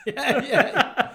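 high burst of laughter; laughing. Real, not acted. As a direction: laugh out loud through the yeahs. Big laugh at joke.

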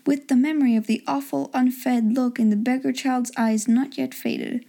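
usual speaking voice

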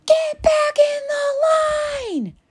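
a hammed-up yell at a kid who stepped out of line; furious scream. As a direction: bark the order at the top of your lungs. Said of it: "screams at the top of her lungs".

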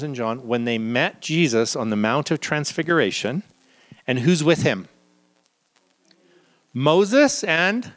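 normal talking voice

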